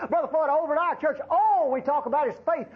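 boastful churchgoer. Retold or imitated. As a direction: squeak it out like a smug churchgoer showing off. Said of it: "You got the wrong message".

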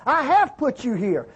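ordinary talk, nothing marked

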